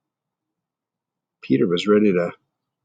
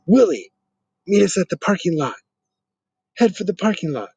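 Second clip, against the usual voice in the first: higher, louder fast call; yelled; 8 semitones higher, 3 dB louder than usual. Acted. Peter yelling a fake plan to fool the bullies, loud. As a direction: shout the trick through the pipe. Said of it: "he yelled through the pipe".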